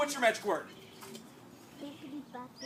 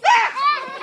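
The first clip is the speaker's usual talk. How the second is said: shocked scream; 17 dB louder than usual. Acted. Flower missing again.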